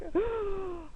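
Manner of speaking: high startled gasp; sharp gasp. Retold or imitated. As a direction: gasp in fright. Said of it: "And I was just like".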